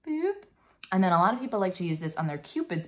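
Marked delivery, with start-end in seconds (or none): 0.1-0.4 high-pitched little "boop"; tiny high nose-tap noise; cute, squeaked-up boop; playful boop. Pretend.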